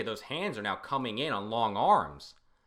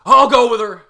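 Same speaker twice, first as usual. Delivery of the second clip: much louder and higher; loud outburst; 13 semitones higher, 23 dB louder than usual. Acted. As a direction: blurt it out loud. Character suddenly gives in.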